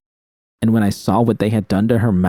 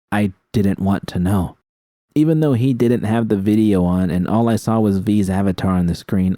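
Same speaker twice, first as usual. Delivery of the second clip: plain reading voice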